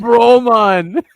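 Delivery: gleeful parroting; mock Canadian. Repeating the cameraman's catchphrase in a loud mock Canadian accent. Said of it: "most Canadian video".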